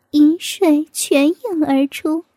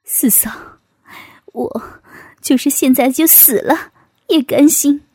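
choked-up; 2 semitones lower than usual. A narrator's reading. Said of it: "哽咽的道". (哽咽着低声说)

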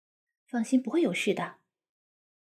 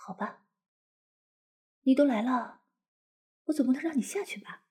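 whisper. Acted. Whispering to husband in cabin.

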